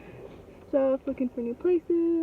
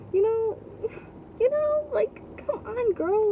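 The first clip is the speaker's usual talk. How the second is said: exasperated disbelief; 10 semitones higher than usual. Real, not acted. Reacting to the other mom's rant, high and incredulous. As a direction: squeal it out in disbelief with a laugh.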